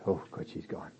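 hushed aside, barely voiced; relieved whisper. Mourners whispering relief that Martha is gone. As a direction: whisper it like gossip glad she's left.

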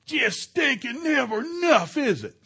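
high, loud mock-angry griping; retold angry yelling. Husband retelling how he yelled at his wife about money, angry yelling. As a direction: yell the complaint, stretching it out in exasperation. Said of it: "I was yelling one day".

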